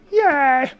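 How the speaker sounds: usual speaking voice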